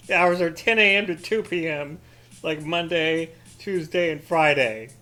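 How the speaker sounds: loud listing of the hours; loud mock-outraged complaint; indignant rant. Real, not acted. Ranting about the shop hours.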